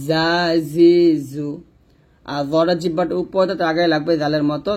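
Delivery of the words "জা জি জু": জা জি জু with a sounding z buzz. Shown as a demonstration. Teacher drilling the buzzing letter, buzzed za. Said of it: "একটা আওয়াজ বের করে".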